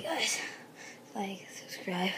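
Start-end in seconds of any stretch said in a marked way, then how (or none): 1.1-2.1 whispering. Real, not acted. Quiet sign-off.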